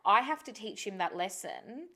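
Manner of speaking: normal talking voice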